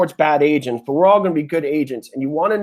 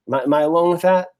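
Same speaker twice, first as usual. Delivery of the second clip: normal talking voice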